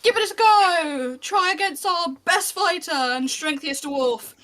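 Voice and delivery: loud, high barker's challenge; calling out. Acted. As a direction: call the crowd to step up, slowly. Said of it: "starts calling and going".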